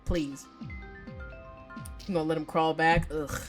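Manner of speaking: ordinary talk, nothing marked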